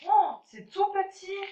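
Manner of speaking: taken aback by how tiny it is; a deflated, surprised little oh; dismayed surprise. Real, not acted. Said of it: "je suis déçue".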